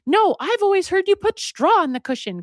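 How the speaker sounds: high-pitched insistent objection; mock arguing. Wife arguing with partner over a pillow, insistent. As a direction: bicker like a storybook spouse. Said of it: "they're arguing in their garden".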